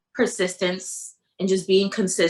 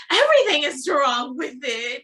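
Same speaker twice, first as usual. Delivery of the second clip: high, hammed-up complaint; exasperated whine; 7 semitones higher, 4 dB louder than usual. Replaying her answer to boyfriend about her belly, exasperated. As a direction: wail it as comic self-criticism.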